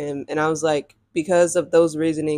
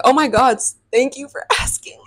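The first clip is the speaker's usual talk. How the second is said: high, exaggerated relief breaking into a laugh; mock-grateful, pitched up, laughing through it; sarcastic thanks; 6 semitones higher than usual. Replaying her thanks for finally asking, sarcastic and high.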